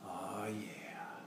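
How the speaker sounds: breathy dreamy whisper. Acted.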